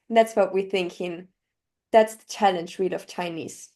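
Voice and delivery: ordinary delivery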